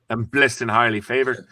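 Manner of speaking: put-on a light Scottish accent on the pastor's line; a hint of a Scottish accent, proud and solemn. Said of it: "with an accent, a Scottish accent".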